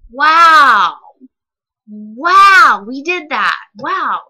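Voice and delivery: a loud disbelieving wow; loud exasperated wow. Real, not acted. To the viewers, about the whole encounter, loud and disbelieving. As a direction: let out the frustration.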